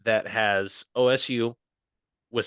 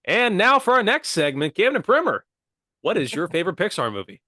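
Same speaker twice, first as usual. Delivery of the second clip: upbeat host voice, higher and louder; mock radio announcer; 7 semitones higher, 4 dB louder than usual. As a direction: announce the next segment like a cheesy radio DJ. Pretend radio segment announcement.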